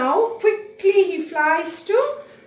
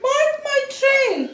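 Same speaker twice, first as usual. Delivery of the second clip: high, loud, urgent call; Mowgli calling; 8 semitones higher, 7 dB louder than usual. Acted. Mowgli shouting up to the kite for help. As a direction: shout it up to the sky. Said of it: "Mowgli says now".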